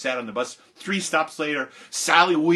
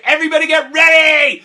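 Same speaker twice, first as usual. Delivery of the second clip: rallying shout; 14 dB louder than usual. Retold or imitated. Amy rallying class once teacher has gone, excited shout. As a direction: yell the signal to the whole room.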